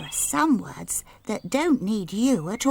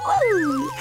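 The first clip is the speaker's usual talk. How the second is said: whoop of joy; 4 dB louder than usual. Acted. Golden Girl thrilled.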